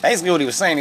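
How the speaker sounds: ordinary talk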